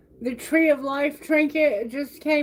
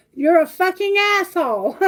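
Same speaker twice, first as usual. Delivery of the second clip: a loud, higher burst of cursing; mock outburst; 5 semitones higher, 4 dB louder than usual. Reading the card, a loud mock insult. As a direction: blurt the insult out like a card shouting.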